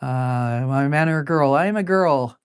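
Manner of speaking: ordinary delivery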